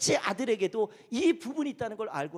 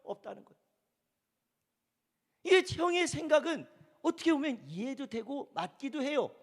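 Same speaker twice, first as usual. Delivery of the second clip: ordinary delivery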